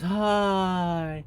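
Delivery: high, lovestruck little hi; spellbound girl. Pretend. Girl under love spell answers.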